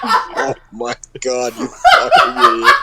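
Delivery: laughing insult. Real, not acted. Player cursing a teammate's blunder, shrieking with laughter. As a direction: laugh it out at your friend.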